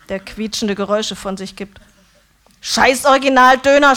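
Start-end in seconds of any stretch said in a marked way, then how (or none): 2.6-4.0 loud furious shout, pitched higher; screaming. A narrator's reading. Döner man screaming at his food, furious. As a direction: scream it while hurling the scraps. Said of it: "schreit er".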